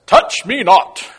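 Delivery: loud, high, slow scripture quote; mock-solemn command. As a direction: forbid it grandly, word by word. Mock-stern quote from King James.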